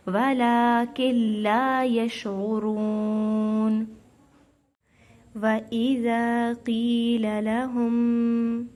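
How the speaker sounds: Quran recitation. Acted. Teacher recites for students in slow chant. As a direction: draw every syllable out like a reciter.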